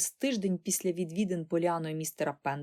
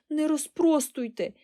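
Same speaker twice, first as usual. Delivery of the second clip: high, slow plea; despairing cry; 7 semitones higher, slower than usual. A narrator's reading. Girl begging aunt to leave the curls, despairing plea. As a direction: plead desperately, stretching the words. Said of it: "розпачливо вигукнула Поліана".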